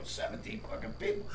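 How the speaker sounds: shocked aside. Retold or imitated. Shocked at audition crowd.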